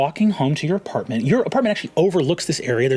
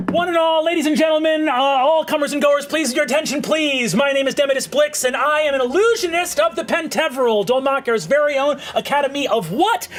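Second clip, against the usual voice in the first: high, brisk carnival barker pitch; gnome showman; 10 semitones higher than usual. Acted. Gnome illusionist hawking to the market crowd, high showman voice. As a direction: hype the crowd like a cocky street magician.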